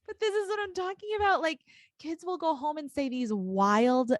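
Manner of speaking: excited. Real, not acted. Excited storytelling.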